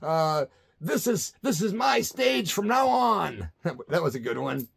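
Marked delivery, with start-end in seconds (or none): 0.8-3.5 higher, slow, mock-grand proclamation; grand declaration. The singer declaring on stage, higher, slow and mock-grand. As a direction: lay claim to the stage like a king, lightly hammed. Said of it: "Gigi declared on stage".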